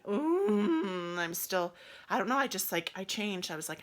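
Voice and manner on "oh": loud, held sympathetic moan; labour-pain moan. Retold or imitated. Friend in the pool sharing labour pains, loud moan. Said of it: "having labor pains with me".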